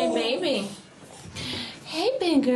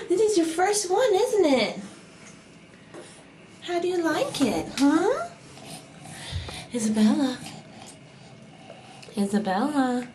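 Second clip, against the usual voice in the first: ordinary talk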